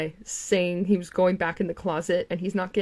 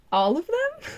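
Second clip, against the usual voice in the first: laughing; 10 semitones higher, slower than usual. Real, not acted. Mocking laugh at the men.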